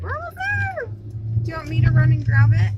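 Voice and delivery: excited. Real, not acted. Excited near the goal.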